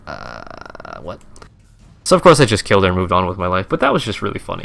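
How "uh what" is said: very low, quiet drawn-out bafflement; dumbfounded. Retold or imitated. Player retelling a baffling chest mission spawn, dumbfounded. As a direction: sink into a low, stumped mumble.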